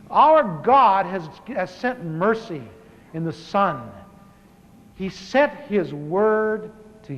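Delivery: slow, emphatic preaching. Acted. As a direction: preach it slowly, each phrase weighed. Preaching at full force.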